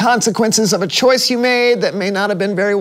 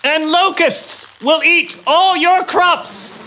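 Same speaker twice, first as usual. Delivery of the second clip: Moses warning; 5 semitones higher, slower than usual. Acted. Moses threatening plagues to Pharaoh.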